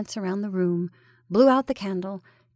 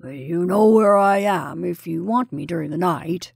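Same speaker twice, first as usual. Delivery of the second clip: Colonel's gruff, slightly lowered voice; gruff undertone; 3 semitones lower, 4 dB louder than usual. A narrator's reading. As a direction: offer help gruffly, as a low-pitched parting word. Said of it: "he murmured in a gruff undertone".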